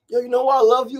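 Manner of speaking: high, loud effusive question; imitated tipsy woman. Tipsy Janae calling out early midday, loud and effusive. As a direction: gush like a friend in her cups. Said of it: "she's already tipsy".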